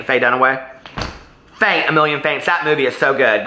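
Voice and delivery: ordinary talk, nothing marked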